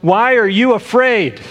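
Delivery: Jesus yelling to his disciples over the wind; yelled over the storm. Retold or imitated. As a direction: shout it across the storm at his frightened friends. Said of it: "Jesus probably has to yell this phrase at them".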